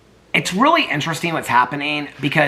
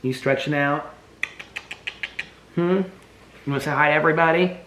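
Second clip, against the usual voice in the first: usual speaking voice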